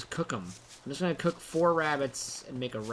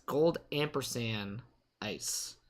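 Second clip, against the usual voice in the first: ordinary delivery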